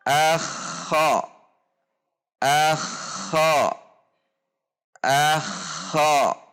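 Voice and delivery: "akha" with a held throaty kh in the middle; doubled kh demo. Shown as a demonstration. Teacher showing tasydid on "akha", held kh. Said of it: "saat tasydid dibaca".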